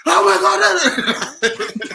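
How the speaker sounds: very loud, high, drawn-out scream; typical commentary outburst. Shown as a demonstration. Showing friends his commentary self, screamed high and long. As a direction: imitate your own over-the-top match reaction, laughing. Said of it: "my typical commentary self will just go".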